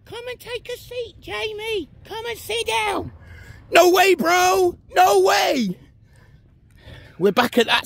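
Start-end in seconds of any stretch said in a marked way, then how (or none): 0.1-3.1 very high, soft coaxing call; tempting bench voice. Pretend. Bench tempting the tired vlogger, high soft coaxing voice. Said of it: "the bench voiced tempting him". 3.7-5.8 high, loud, slow mock refusal; defiant refusal. Vlogger refusing the bench's invitation, loud defiant shout.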